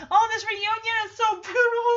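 high, emotional gush. Real, not acted. Viewer moved by the reunion, high gushing.

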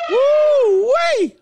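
high, loud falsetto woo-wee; excited whoop. Real, not acted. Reacts loudly to pictures.

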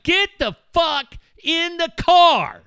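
angry shout. Retold or imitated. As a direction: bark the order at the kids. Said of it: "I'm like".

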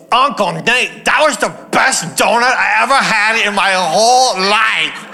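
put-on loud happy kid voice; gleeful child shout. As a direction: blurt it out proudly like a kid. Child announcing loudly during prayer.

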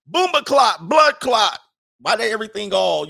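high, loud fake Jamaican accent; mock Jamaican accent. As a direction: shout it in a hammed-up Jamaican accent. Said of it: "I wouldn't get a Jamaican accent".

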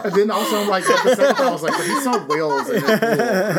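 loud, high, laughing teasing; cracking up. Real, not acted. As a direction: tease him through real laughter. Cracking up at the confident guess.

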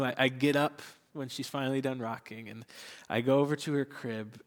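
ordinary talk

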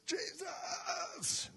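high strained cry of Jesus; beggar's desperate cry for Jesus. Acted. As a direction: cry out with all you have. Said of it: "Pretty good, huh?".

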